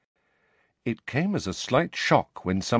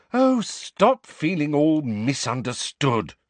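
slow, higher, sharp rebuke; 7 semitones higher, 3 dB louder, slower than usual. A narrator's reading. Hermione scolds sulking Harry, sharp. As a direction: cut him off sharply. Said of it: "said Hermione sharply".

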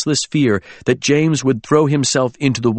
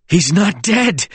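high, drawn-out desperate cry; desperate protest; 8 semitones higher, slower than usual. A narrator's reading. James writhes underneath Oliver, desperate protest. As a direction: insist on it while being pinned.

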